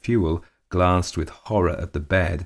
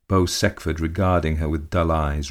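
usual speaking voice

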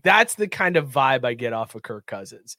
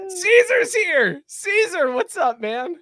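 higher and louder, drawn-out greeting; excited welcome; 13 semitones higher, 6 dB louder, slower than usual. Real, not acted. Host welcoming a guest who just joined, excited greeting. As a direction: greet a buddy joining the show.

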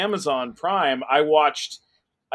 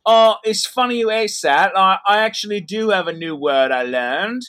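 higher, louder, lightly voiced bubbly British guest; imitating Chloe; 3 semitones higher, 6 dB louder than usual. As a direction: mimic her eager ramble. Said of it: "And she said".

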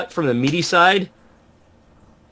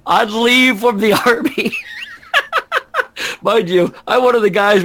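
laughing while talking; 8 semitones higher than usual. Real, not acted.

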